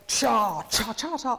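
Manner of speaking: heavy "cha" repeated on every note alike; unwanted even accents. Shown as a demonstration. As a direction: demonstrate the wrong way, each "cha" equally heavy. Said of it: "I wouldn't want it to go like".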